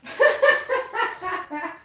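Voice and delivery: high-pitched burst of laughter. Real, not acted. Owner amused at limping cat climbing, high laugh. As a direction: crack up helplessly.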